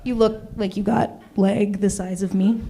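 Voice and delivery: ordinary delivery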